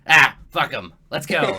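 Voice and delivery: loud offhand brush-off; dismissive goblin boss. Acted. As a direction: shrug it off loudly and move on.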